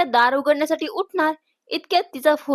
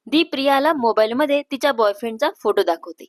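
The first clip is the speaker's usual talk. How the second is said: ordinary delivery, nothing marked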